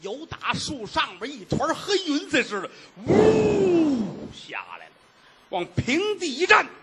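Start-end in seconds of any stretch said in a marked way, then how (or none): 3.1-4.3 high whoosh of something falling; imitated rush of air; whooshing sound. Dark figure drops from the tree, high whooshing sound.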